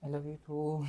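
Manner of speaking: very soft, low mumbled reply; barely audible. Acted. Friend answering best friend's I love you, barely audible. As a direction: return it almost under your breath. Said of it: "di ko marinig".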